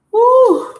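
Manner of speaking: loud high "woo"; short, loud exclamation; high whoop. Real, not acted. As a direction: let out a sudden high whoop. Exclaims as cards come out.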